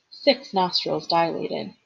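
ordinary delivery, nothing marked

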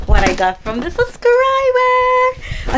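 drawn out high and slow, thrilled; excited. Real, not acted. To her viewers, about the subscriber's gift, thrilled and drawn out. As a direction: savour the words like a treat.

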